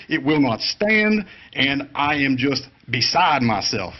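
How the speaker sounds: hammed-up Southern courtroom objection; Southern lawyer voice. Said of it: "in my best Southern lawyer voice".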